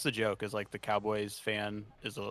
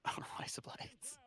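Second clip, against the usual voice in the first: whispered attempt at Aussie; Australian accent; 11 dB quieter, slower than usual. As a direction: quietly test out an Australian accent, slowly. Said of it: "I can't do an Australian accent".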